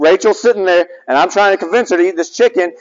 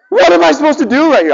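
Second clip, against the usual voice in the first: exasperated dad; 10 semitones higher, 4 dB louder, faster than usual. Retold or imitated. Exasperated dad arguing with daughter.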